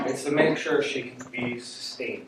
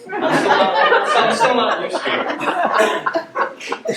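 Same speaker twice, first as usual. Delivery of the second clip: laughing; 11 semitones higher, 5 dB louder, much slower than usual. Real, not acted. Laughing at the memory.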